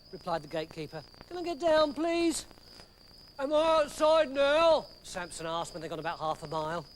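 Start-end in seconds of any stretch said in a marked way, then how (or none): 1.3-2.4 high, pleading little man; higher, timid gatekeeper pleading; frightened gatekeeper. Acted. 3.4-4.9 higher, innocent giant asking; Samson, dim strongman. Acted.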